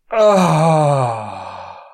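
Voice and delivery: loud, long acted moan; loud guttural cry of release. A narrator's reading. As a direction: let out a drawn-out moan that falls away. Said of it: "lets out a guttural moan".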